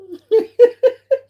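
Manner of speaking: squealing high laugh; real giggle at the chat. Real, not acted.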